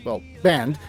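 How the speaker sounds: normal talking voice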